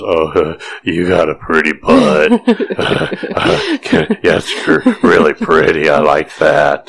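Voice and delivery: leer it out like a creep; creepy come-on voice. Pretend. Mock hitting on gym guy.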